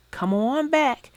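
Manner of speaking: ordinary delivery, nothing marked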